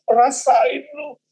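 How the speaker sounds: slow, high mocking devil taunt; gloating devil. Devil gloats at the angry believer, slow high taunt. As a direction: drag out the sneer like a devil in the corner.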